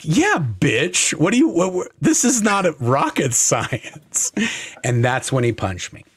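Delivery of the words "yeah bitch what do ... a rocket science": cocky retort. Retold or imitated. Retold taunt at the boss.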